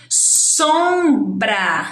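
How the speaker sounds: "sombra" slow, as "soom-braa"; long, deliberate "som-bra"; syllable by syllable. Shown as a demonstration. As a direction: stretch both syllables of "sombra". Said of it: "dois sons".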